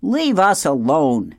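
slow, loud, high weasel squeak. A narrator's reading. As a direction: squeak out a drawn-out plea. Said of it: "squeaked Dart".

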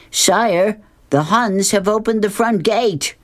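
high, urgent alarm; panicked knight. Acted. Sir Rodney warns the king, high and panicked. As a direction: blurt the news in a high panic. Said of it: "in a bit of a panic".

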